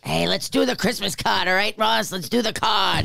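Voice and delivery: a Harrison Ford impression, leaning hard into gruffness. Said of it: "Is that your Harrison Ford?".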